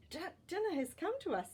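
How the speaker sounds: high, fast gleeful father voice; father delighted at dinner, voiced high; greedy father. A narrator's reading. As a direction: gloat over the catch, quickly. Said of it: "said the boy's father".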